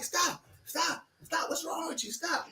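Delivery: high, soft, repeated plea; backing-away mother. Retold or imitated. Mother backing away from crazed son, high alarmed protest. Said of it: "she was backing up".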